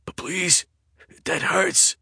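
high, frightened begging; terrified plea. A narrator's reading. Eddie begs the intruder with a knife at his neck, high and terrified.

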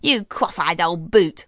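slow, scornful put-down from a lightly voiced sister; snorting Miranda. Miranda insults her sister Daphne, slow and scornful. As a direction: sneer the insult out slowly. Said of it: "snorted Miranda".

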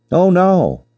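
ordinary delivery

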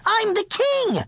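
very high, petulant squeal; shouted defiance. A narrator's reading. Spoiled king pulling rank on the cook, shouting. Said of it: "he shouted".

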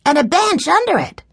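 very high-pitched exclamation; excited cry. A narrator's reading. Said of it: "cried Benny".

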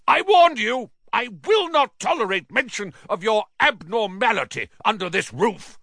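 high, furious tirade; roar. A narrator's reading. Uncle laying down the law to nephew, roaring. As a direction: rant at full outrage. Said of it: "roared Uncle Vernon".

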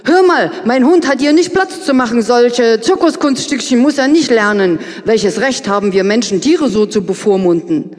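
indignant outburst. Acted. Narrator bursting out at Vera.

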